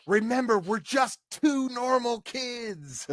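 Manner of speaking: high, drawn-out mock whisper in full voice. Kid warning Brandon to act normal, mock whisper. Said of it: "Justin whispered to Brandon".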